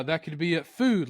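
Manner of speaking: ordinary talk, nothing marked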